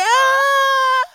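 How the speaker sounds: high shouted gasp of joy; joyful cry. Acted. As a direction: let out a sudden joyful cry. Mary's gasp of recognition.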